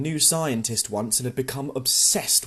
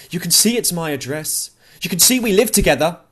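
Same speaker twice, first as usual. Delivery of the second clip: loud, fast, exasperated insistence; a grieving woman snapping at a stubborn clerk; rising anger; 6 semitones higher, 8 dB louder than usual. A narrator's reading. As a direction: push back hard at the clerk, voice climbing. Louise confronting the counter clerk.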